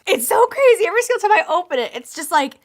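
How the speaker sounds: high, loud and fast gushing; breathless excited gush; overwhelmed excitement. Real, not acted. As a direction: rush the words out in delight. Overwhelmed by the box.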